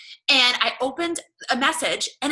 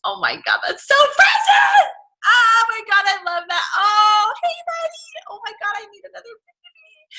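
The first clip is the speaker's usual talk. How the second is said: very high, loud, half-crying coo; squealing gush; 11 semitones higher, 8 dB louder than usual. Real, not acted. Mom coos at a baby over a voice message, squealing. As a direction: melt over something adorable.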